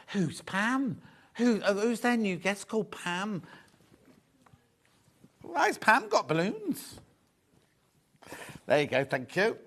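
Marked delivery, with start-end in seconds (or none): 0.1-7.0 mock baffled questions, rising to a high, slow last line; puzzled retelling. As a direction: play her confusion, climbing high on "Why has Pam". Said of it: "and I'm going".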